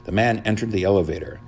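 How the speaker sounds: ordinary delivery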